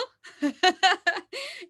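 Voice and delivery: high, loud burst of laughter; real joyful laugh; bright laugh. Real, not acted. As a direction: laugh out with delight. Laughs at her own mirror moment.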